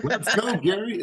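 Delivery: high, cheering call; excited cheer. Real, not acted. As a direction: cheer him on with real excitement. Host cheers after the answer.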